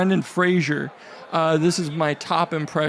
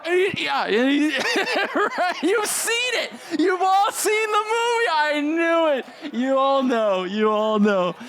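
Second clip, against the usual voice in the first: much higher, laughing straining voice; Whale impression; 10 semitones higher than usual. Comic doing The Whale bit, high laughing strain. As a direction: strain up high as if standing with huge effort. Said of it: "This is how it goes".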